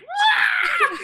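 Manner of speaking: very high, loud mock scream; girlish scream. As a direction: imitate a man shrieking like a little girl. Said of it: "he screams like a girl, like a little girl".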